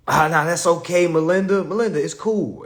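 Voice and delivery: breezy reassurance. Retold or imitated. Reassuring the dealer loudly.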